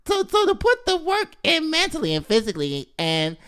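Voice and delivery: very high, tiny squeaky voice; gymnastics voice. Acted. Host mimics girl gymnast reading her statement, squeaky little voice. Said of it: "That's my gymnastics voice".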